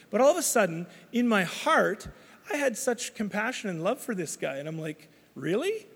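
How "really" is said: incredulous. Retold or imitated. Shocked by sudden compassion.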